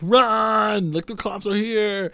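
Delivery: high, drawn-out mock cry of alarm; hammed-up yell to scatter; urgent warning. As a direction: call it out like a retold panic. Acting out the crowd's panicked warning.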